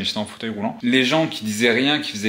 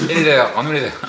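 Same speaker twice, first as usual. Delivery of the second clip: higher, louder playful call-out; raised voice, mock accusing; mock demand; 4 semitones higher, 5 dB louder than usual. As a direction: pile on the mock demand to an absent friend. Mock plea to absent Angélique.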